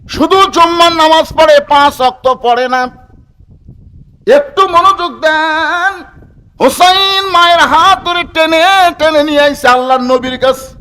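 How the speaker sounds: high, loud chanted waz delivery; full-force preaching. Real, not acted. (চড়া সুরে জোরালো ওয়াজ)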